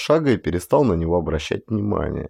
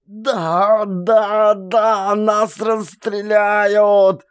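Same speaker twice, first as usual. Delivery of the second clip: much higher, slow desperate scream; screaming in panic; 12 semitones higher, much slower than usual. A narrator's reading. Doomed soldier screams at the top of his lungs. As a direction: shriek it at full throat. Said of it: "И опять во всё горло".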